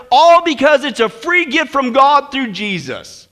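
loud, high, fervent sermon climax; rousing pulpit delivery; full-force preaching. Real, not acted. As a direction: build to a triumphant proclamation.

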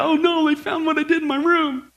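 put-on playful guilty-child panic; mock worried child. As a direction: voice a kid who fears being found out. Acts out child's worried thought.

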